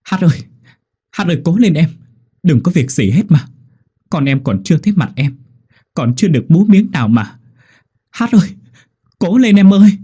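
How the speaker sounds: high, loud tearful pleading; crying plea. A narrator's reading. Midwife weeping over the dying mother, high and loud. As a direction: beg her to hold on through tears. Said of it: "vừa khóc".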